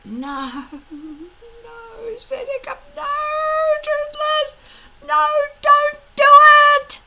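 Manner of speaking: high, loud frantic pleading; panicked plea. A narrator's reading. Hiccup begging Toothless not to insult Fireworm. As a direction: beg him to stop, panicking.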